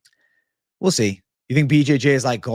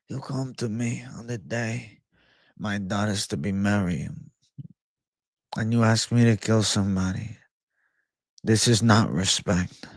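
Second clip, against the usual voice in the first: the Godfather; 3 semitones lower than usual. Acted. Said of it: "Hit us with the Godfather line".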